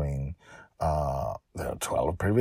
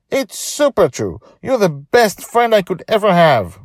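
high, loud insistence; earnest girl; 10 dB louder than usual. Acted. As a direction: insist he matters. Joy insists to Charlie.

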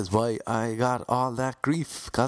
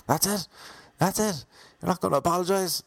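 much higher, huffy mock outrage; high horse indignation; 9 semitones higher than usual. Freed suspect demanding an apology from police, much higher mock indignation. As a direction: act wronged and demand the apology. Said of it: "now on my high horse".

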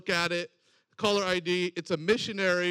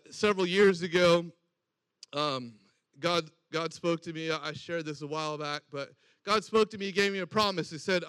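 ordinary talk, nothing marked

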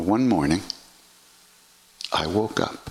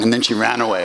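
ordinary delivery

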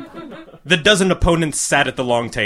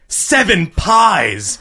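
slow, higher, stunned count; astonished mutter; 5 semitones higher, much slower than usual. A narrator's reading. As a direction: drag it out in disbelief. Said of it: "one muttered in astonishment".

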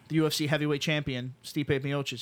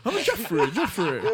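high, giggly put-on voice; silly Jeffrey voice; 6 semitones higher than usual. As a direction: play a goofy Jeffrey while cracking up. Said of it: "He's like".

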